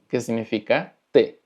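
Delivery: ordinary talk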